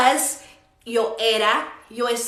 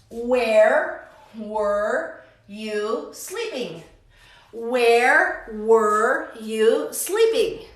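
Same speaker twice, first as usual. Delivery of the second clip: very slow, each word separate; slow model question; much slower than usual. Shown as a demonstration. Teacher models a where question for students, very slowly. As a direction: draw it out word by word for learners.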